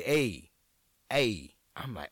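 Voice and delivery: ordinary talk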